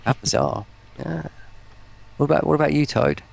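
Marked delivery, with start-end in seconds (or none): none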